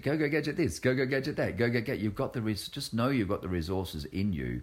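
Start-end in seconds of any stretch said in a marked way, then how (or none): 0.0-2.0 higher, lightly voiced "go, go, gadget"; a hint of the cartoon inspector; Inspector Gadget catchphrase. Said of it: "go, go, gadget with Inspector Gadget".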